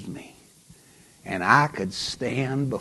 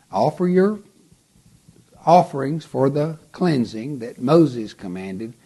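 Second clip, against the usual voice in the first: commanding Jesus; 6 semitones higher, 7 dB louder than usual. Acted. Jesus commands the healed leper.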